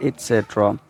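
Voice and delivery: "et cetera" as it-SET-ra; careful model "et cetera", no K; correct pronunciation. Shown as a demonstration. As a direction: repeat "et cetera" with a clear T. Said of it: "इसको हमने बोलना है".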